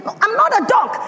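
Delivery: put-on high, insistent little girl; child's protest. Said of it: "and I said".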